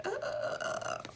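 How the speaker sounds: sharp breathy ugh, pitched high; a quick unvoiced groan of distaste; disgusted ugh. Reader groaning on pulling the Devil card, disgusted ugh.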